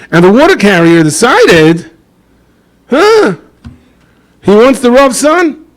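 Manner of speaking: proud water carrier. Retold or imitated. Rich water carrier decides he wants the Rav's son, high and grand. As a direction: puff up with new-found wealth.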